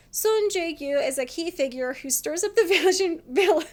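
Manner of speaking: reading while starting to laugh; real laughter. Real, not acted. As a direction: read it out until laughter breaks it up. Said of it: "I'm laughing because it's true".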